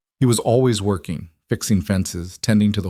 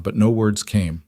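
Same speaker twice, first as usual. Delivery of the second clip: usual speaking voice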